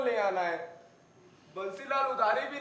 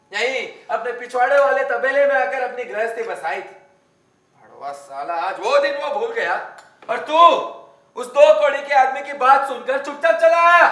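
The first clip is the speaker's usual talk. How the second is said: loud, contemptuous scolding; angry ranting; 9 dB louder than usual. Acted. Man berates Chandu for returning silently, angry ranting. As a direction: rail at the creditor, then turn on the messenger. Rants at creditor, scolds messenger.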